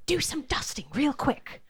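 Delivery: whispered instruction to a servant; hushed order. Acted. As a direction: hiss it urgently under the breath.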